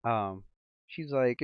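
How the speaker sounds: usual speaking voice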